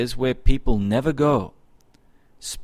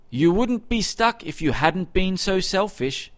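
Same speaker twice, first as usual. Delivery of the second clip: higher-pitched, understated telling-off; mild scolding; 7 semitones higher than usual. A narrator's reading. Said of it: "scolded the girl".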